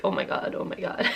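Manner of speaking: soft unvoiced, horrified; hushed disbelief. Confessing her slip to friends, a hushed whisper. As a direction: breathe it out to friends.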